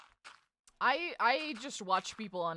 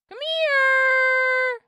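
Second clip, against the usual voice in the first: high call across a field; high loud call; 13 dB louder than usual. Acted. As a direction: shout it high and long. Calling animals.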